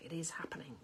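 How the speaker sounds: grim, soft whisper; hushed deadpan whisper; whispered reply. Retold or imitated. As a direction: breathe it out flatly. Her grim reply to workmen.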